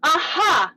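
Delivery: strained warm-up aha; forced aha. Shown as a demonstration. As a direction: push the aha too high and hard. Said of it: "If you're like".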